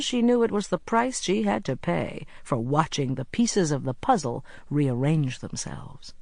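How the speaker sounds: usual speaking voice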